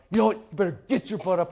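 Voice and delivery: quick mock tough-dad order; gruff guy version. Dad manipulating his kid, mock tough and gruff. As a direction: order the kid up like a fed-up father. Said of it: "That's the guy version of".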